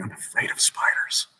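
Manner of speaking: hushed admission of fear; whispered fear. Acted. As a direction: breathe it like a secret dread. Private fearful thought.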